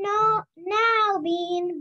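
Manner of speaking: mother snapping back, mildly cranky; cranky mom. A narrator's reading. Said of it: "Bean's mother sounded cranky".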